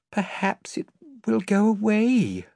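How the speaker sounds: put-on high, hopeful girl's voice; a girl hoping aloud; young girl voice. A narrator's reading. Lucy voiced in the story.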